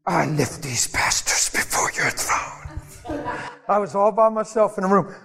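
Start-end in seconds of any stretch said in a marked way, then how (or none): 0.1-2.6 hushed, breathy intercessor prayer; mock-pious prayer voice, heavy with breath; spiritual voice. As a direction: put on a solemn, whispery praying voice. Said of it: "spiritual voice on".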